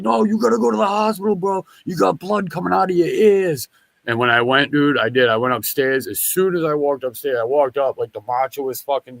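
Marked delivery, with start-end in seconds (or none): 0.0-3.7 urging stranger. Retold or imitated. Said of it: "He's like".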